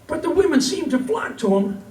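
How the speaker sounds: lively, lilting comic verse for a crowd; cowboy poetry recital. Acted. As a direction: tell it as a rhyming yarn from the stage. Recites comic cowboy poem to audience.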